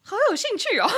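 high, loud teasing squeal into a laugh; mock-eager laughing. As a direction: pretend to be far too interested, then burst out laughing. Joking about sleazy venues.

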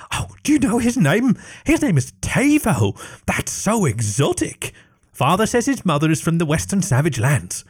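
high, prim little princess voice; a high, prissy girl's voice stating facts; matter-of-fact little girl. Acted. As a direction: chatter on like a know-it-all child. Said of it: "stated matter-of-factly".

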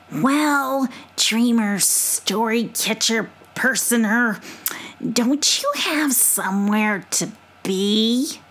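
slow, dismissive possum. Acted. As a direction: shoo him off like a possum. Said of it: "in her possum voice".

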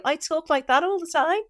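high-pitched, exaggerated Scouse; old Scouse accent. Shown as a demonstration. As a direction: demonstrate your old Scouse, pitched right up. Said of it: "'cause I talked like that".

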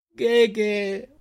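put-on a girl's high desperate scream. Selin screams for her brother, high desperate cry. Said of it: "urlò lei".

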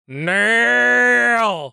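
hammy cartoon cry of the name; mock anime shout; Dragon Ball Z Abridged yell. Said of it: "Dragon Ball Z Abridged".

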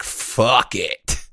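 low, dismissive mock-damned voice; defiant shrug. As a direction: toss it off like you're past caring. Voicing the carefree damned.

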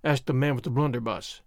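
plain reading voice

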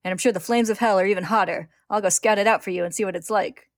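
loud, manic bravado; shouting. A narrator's reading. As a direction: keep shouting the taunt, gleefully. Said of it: "he shouts".

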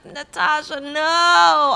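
high, loud mock plea; dismayed plea. Viewer calling out to Natasha on screen, dismayed plea.

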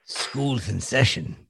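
rough action-star impression attempt; Jason Statham. Host teasing co-host with his Jason Statham impression. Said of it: "that was just [name] doing Jason Statham".

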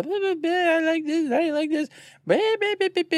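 high, far-off mocking blah-blah voice; mock chatter. Host mocks their own rambling in a high silly voice. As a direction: parrot our endless chatter in a silly high voice.

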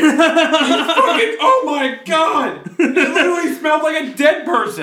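high, loud laughing exclaim; laughing real disgust at the stink. Real, not acted. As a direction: shriek it out between laughs.

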